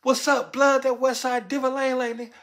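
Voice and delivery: high, loud gang call-out; imitated gang member hollering; gang banging. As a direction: shout out the set as a challenge.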